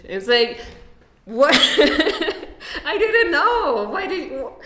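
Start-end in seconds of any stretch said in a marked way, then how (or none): 1.2-4.6 high, laughing disbelief; incredulous. Acted. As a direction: protest in baffled amazement, pitched up and laughing. Acts out stunned reply to belated grievance.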